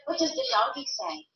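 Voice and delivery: lively picture-book question. Real, not acted. Parent reading picture book to toddler.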